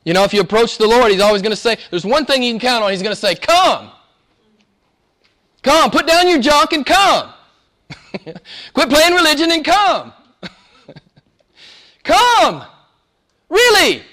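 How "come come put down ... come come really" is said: high, loud, drawn-out preaching cry; full-force preaching. Acted. Preacher crying out the disciples' longing to the church, high and loud. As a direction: lift the congregation, stretching each phrase.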